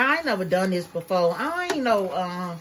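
ordinary delivery

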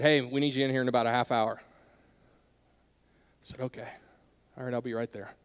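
soft, low, defeated okay; resigned quiet reply. Retold or imitated. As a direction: give in quietly to the call. Boss calls, resigned reply.

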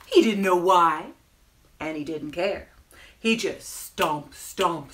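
slow, stretched-out storytelling with punched key words; children's story narration. A narrator's reading. Storyteller reading about a grumpy penguin, animated narration. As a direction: draw out the phrases for small children. Reads picture book to children.

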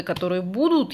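normal talking voice

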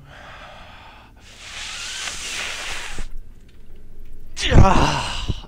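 long breath swelling into a loud release; long nervous exhale. Real, not acted. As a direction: breathe out hard to brace yourself. Bracing to go on.

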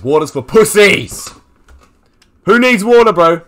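high, loud mock bravado; mock macho shout. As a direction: shout it with exaggerated gamer bravado. Mock macho outburst at chat.

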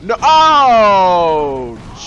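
long, loud wail of no; dismayed yell. Real, not acted. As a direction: cry out as it all goes wrong. Player's character falls or dies.

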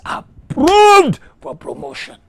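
high, explosive shout of approval; climactic shout. Real, not acted. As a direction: cry the verdict out at full force. Climax of the declaration.

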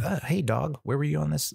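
high, sing-song questioning of the dog; mock talking to dog. To the guard dog that stayed silent, mock high scolding. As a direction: tease the useless dog in a high voice.

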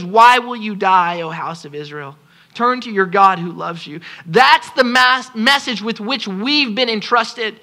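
ordinary talk

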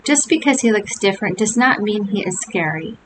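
ordinary delivery, nothing marked

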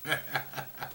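quiet unvoiced laugh; breathy laugh. Real, not acted. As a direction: laugh under your breath. Laughing at his own punchline.

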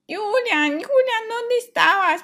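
high, loud call across the house. Acted. Sister calling Julian to come eat, loud call. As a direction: shout for him to hurry to the ice cream. Sister calls brother to ice cream.

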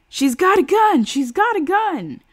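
high, loud alarm; panicked shout. Acted. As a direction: scream the warning. Panicked crowd member warns.

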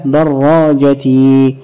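دراجتي slowly as darraajatii; long possessive ya. Shown as a demonstration. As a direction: draw out the final "ii" of دراجتي. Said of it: "ضفنا ياء الملكية".

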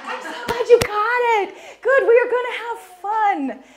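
high, laughing tease at the crowd; playful teasing laugh. Real, not acted. Teasing audience about answer.

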